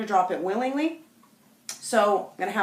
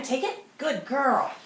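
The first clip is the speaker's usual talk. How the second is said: upbeat praise; 5 semitones higher, slower than usual. Real, not acted. Praising dog.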